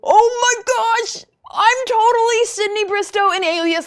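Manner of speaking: high, loud gushing thrill; hammed-up retold exhilaration; over-the-top excitement. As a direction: squeal it like a starstruck fan. Retold thrill at spy fantasy.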